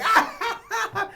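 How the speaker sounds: high, loud laughing cry; laughing shout. Retold or imitated. Director reacting to the stunt, loud high laughing yelp. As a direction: let out a delighted yelp. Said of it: "he's like".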